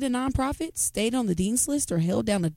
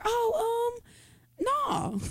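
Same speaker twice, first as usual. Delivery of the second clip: high mock voice; 14 semitones higher, 6 dB quieter, much slower than usual. Acting out a dismissive reply.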